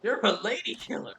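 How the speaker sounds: high excited salesman flattering a stranger; eager clerk. Acted. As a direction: gush over the customer like a pushy salesman. Reads clerk's flattering lines.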